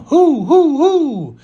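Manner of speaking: high, loud hooting who; playful rising hoots of suspense; excited teasing. Acted. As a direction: tease the mystery like an owl.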